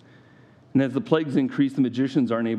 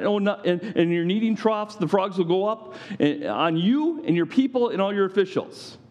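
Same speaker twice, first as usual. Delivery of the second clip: mock God going on; 7 semitones higher than usual. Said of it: "he's going on and on and on".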